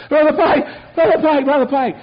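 imitating a distraught caller, high and loud; high crying voice. Distraught man phoning his pastor, high crying voice. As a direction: cry out in a high, urgent mock sob. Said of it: "his voice was high, and he's shaking, crying".

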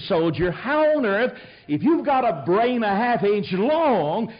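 fired-up sermon appeal; preaching. Acted. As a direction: drive it home to the congregation. Preacher drives home his point.

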